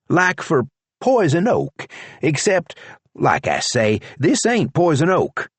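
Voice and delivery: deputy complaining about his leg; Charlie's voice. A narrator's reading.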